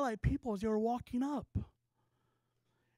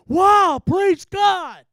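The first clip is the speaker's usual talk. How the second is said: loud, high cry of astonished praise; amazed shout; 8 semitones higher, 17 dB louder, much slower than usual. Retold or imitated. Street rapper freaks out at a prophetic word, amazed shout. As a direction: belt it out in wonder, much louder and higher. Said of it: "And he was amazed".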